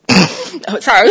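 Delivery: imitated toddler apology, bursting out loud; too-loud apology. Toddler finally apologizing to her mother, too loud. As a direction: blurt a sudden loud sorry. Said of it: "It was way too loud".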